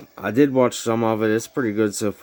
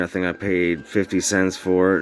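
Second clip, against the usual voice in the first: usual speaking voice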